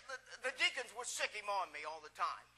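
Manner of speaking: ordinary delivery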